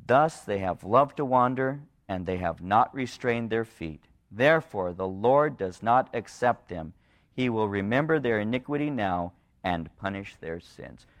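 low, measured verse reading; solemn scripture reading. Real, not acted. Preacher reads God's verdict to congregation, solemn reading. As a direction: read it gravely, lingering on the words.

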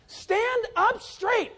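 slow, high shouted command; shouted instruction. Retold or imitated. Pastor calling to the boy skiing, loud slow shout. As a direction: yell it across the water. Yelling to skier.

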